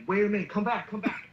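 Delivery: Roman calling them back. Acted. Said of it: "Roman's like".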